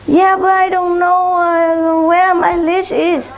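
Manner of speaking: lightly voiced fake-innocent neighbor; mock neighbor excuse. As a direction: play dumb like the neighbor lady.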